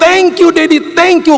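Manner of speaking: very high, loud squeal; child's joyful shriek. Retold or imitated. Child hugging dad who bought it, imitated high loud shriek. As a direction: squeal thanks like an overjoyed kid. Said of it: "Dia makin berteriak".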